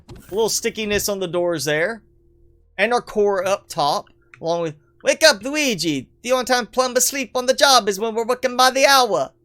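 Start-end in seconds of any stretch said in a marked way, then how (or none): 5.0-9.3 higher, cartoon plumber rousing his brother; mock plumber scolding. Mario to sleeping Luigi on the job, high mock scolding. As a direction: play a high-voiced plumber nagging.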